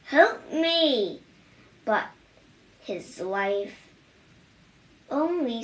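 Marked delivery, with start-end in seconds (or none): 0.1-1.2 high, louder plea; crying for help. A narrator's reading. Old mouse runs home and cries to his wife for help. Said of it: "and cried".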